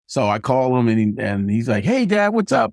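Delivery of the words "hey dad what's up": imitating a son picking up, high-pitched; chirpy, high mock hello to Dad; cheery phone greeting. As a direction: pitch it way up, casual and friendly. Son answers dad's phone call.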